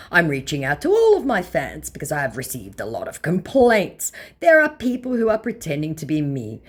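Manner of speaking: hammed-up impersonation of a celebrity impostor; Daniel Henney. Vlogger reading out a scammer's message, in a hammed-up mock voice. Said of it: "I want you to meet Instagram user previously known as Daniel Henney".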